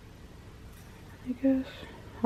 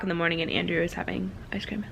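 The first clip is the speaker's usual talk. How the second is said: whisper; 3 semitones lower, 10 dB quieter, faster than usual. Real, not acted. Whispered tease about Andrew's ice cream.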